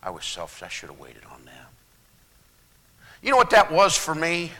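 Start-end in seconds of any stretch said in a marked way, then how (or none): 0.0-1.7 very low, soft, fading into a whisper; humble whisper. Retold or imitated. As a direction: admit it in a hushed voice. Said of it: "I said".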